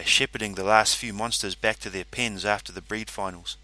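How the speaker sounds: plain reading voice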